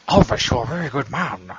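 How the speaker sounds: a hint of a stiff Nixon; lightly voiced president praising [name]; Nixon impression. Joking about the [name] and Nixon photo, lightly voiced Nixon.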